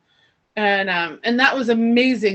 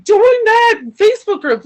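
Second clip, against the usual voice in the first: high, loud, disbelieving repeat; incredulous quote; 11 semitones higher, 8 dB louder than usual. Retold or imitated. Woman retelling the inner voice, high and incredulous.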